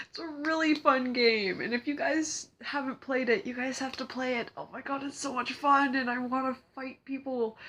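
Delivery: high-pitched fan enthusiasm; excited gushing. Real, not acted. Gushing over a fun game.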